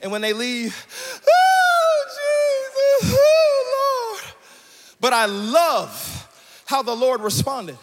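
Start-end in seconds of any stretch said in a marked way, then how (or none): 1.3-4.3 hammed-up sob, much higher, slow; mock ugly cry. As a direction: wail comically once the attendant leaves. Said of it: "You know that ugly cry".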